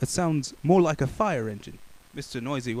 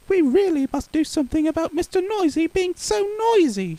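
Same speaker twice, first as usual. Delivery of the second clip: much higher fussy lady's voice; Missus Crumb; 5 dB louder than usual. Acted. Baker complaining to the butcher in a high voice.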